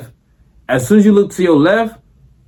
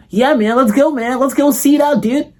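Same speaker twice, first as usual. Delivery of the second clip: much higher, excited buddy voice; eager Terry; 10 semitones higher than usual. Retold or imitated. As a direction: jump at the haunted house idea. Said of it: "If you tell fucking Terry".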